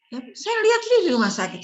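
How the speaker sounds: teacher insisting to the class she saw the ward herself; indignant insistence. Real, not acted. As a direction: insist hotly that you saw it yourself.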